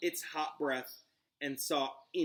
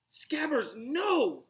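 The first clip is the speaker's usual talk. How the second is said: high, loud cry; 11 semitones higher, 6 dB louder, much slower than usual. Acted. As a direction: call out in alarm. Ron crying out as rat escapes.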